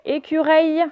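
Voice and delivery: "écureuil" said as "écureil", ending "-eil" for "-euil"; wrong pronunciation. Shown as a demonstration. Teacher showing what a missing U does, mispronounced "écureil". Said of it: "vous n'avez pas oublié le U, sinon ça fait".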